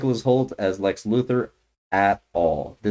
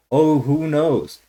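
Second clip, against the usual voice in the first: higher and slow, teasing; mocking smug tone; 6 semitones higher, 3 dB louder, much slower than usual. Mocking the director's supposed cleverness, mocking smug tone. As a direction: drawl it out like a smug know-it-all. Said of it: "to be like".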